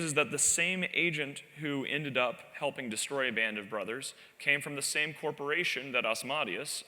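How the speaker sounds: ordinary talk, nothing marked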